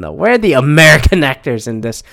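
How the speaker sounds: ordinary talk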